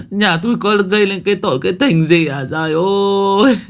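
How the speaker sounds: high, loud keening cries of grief; a widow sobbing out her husband's loss; wailing lament. A narrator's reading. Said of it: "vật vã gào khóc".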